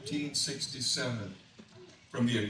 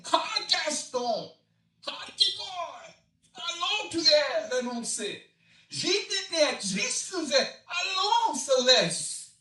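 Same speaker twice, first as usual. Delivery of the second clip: high Cajun Santa calling out the gators' names; high, loud calls to the alligators; 13 semitones higher, 4 dB louder than usual. Acted. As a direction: holler at the team like a driver.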